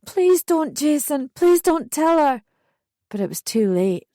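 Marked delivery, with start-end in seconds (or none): 0.0-2.4 high, loud desperate plea; high-pitched, tearful begging. A narrator's reading. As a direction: plead with him, panicking. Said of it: "I begged, crying now".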